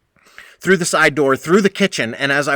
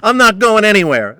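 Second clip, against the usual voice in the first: higher, louder defiant whine; petulant child; 8 semitones higher, 8 dB louder than usual. Retold or imitated. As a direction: plant your feet and refuse like a sulky kid. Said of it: "like a fucking petulant child".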